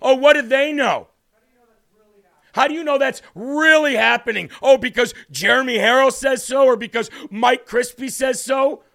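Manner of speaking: loud, slow sing-song sneer; shouted mock sing-song of the critics. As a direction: ham up the scoffers mockingly. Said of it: "sick and tired of people".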